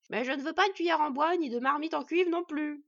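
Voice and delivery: loud, high, cranky dwarf voice; grumpy warning. A narrator's reading. Dwarf behind the door to a supposed pedlar, loud and cross. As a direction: warn the pedlar off at full voice. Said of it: "les prévint la voix".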